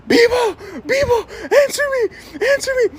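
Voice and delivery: hammed-up worried mom calling; mock frantic calling. Mom calling her son through the wall, mock frantic. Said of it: "she starts screaming my name".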